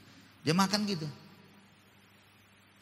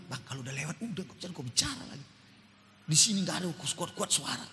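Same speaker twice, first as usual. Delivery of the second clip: much softer, quick hissed rebuke; hushed scolding; 2 semitones lower, 6 dB quieter, faster than usual. Retold or imitated. Younger sibling quietly tells brother to stop calling out. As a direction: hush him so no one hears. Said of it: "Dia bilang".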